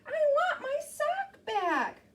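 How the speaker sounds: put-on high, slow girl voice; little girl voice. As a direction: plead like a small girl. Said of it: "she said".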